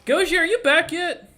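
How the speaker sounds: high, loud call to someone away. Real, not acted. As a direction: holler to check if they're back. Calling an absent player back.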